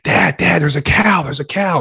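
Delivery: put-on high, loud, excited child calling to dad; a little boy spotting his first cow; excited kid. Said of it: "his son's like".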